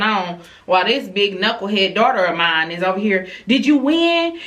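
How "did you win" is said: high, nosy sibling question; imitated eager sister. Mother mimicking oldest daughter while sister is consoled, eager and nosy.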